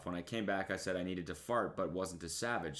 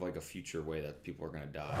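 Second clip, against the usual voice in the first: ordinary talk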